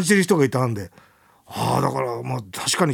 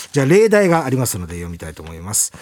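ordinary talk

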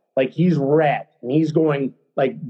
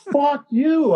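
high-pitched retold outburst; heated, raised-pitch defiance; defiant retort; 11 semitones higher than usual. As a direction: throw the insult back, pitched way up. Retold quarrel with coach.

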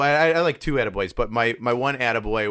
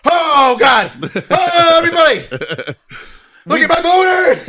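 high, shouted, hammed-up elation; overjoyed; 12 dB louder, slower than usual. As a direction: crow to everyone in comic ecstasy. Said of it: "I never felt so happy".

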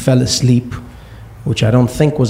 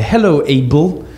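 Abel spelled out slowly and deliberately; crisp, slow, pointed greeting; enunciated; 3 semitones higher than usual. Retold or imitated. Boy greeting his stepfather, slow and pointed. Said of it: "I made sure I enunciated it".

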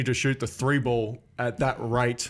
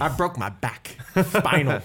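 lisping boxer impression; Mike Tyson; 2 semitones higher than usual. Co-host clowning over a tricky surname, lisped boxer impression. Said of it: "say that one like Mike Tyson".

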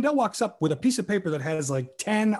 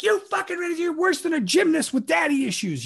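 scripted insult in a high, exasperated voice; acted tirade; 13 semitones higher than usual. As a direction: play an insult-hurling rant, pitched way up. Acting out scripted tirade from show.

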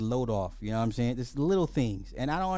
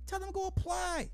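high sing-song. Acted. Telling grown kids' parents to apply, high sing-song. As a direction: jump up high and chant it lightly.

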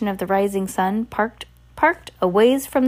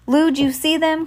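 high, raised questioning call; 8 semitones higher, 4 dB louder than usual. A narrator's reading. Shopkeeper checks with the delivery person, calling out. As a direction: call across the aisles. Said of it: "called the shopkeeper".

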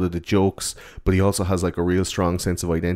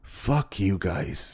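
mock defiance; 6 dB quieter, much slower than usual. Horace brushing off the bullies, understated slow defiance. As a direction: drop it quietly, taking his time.

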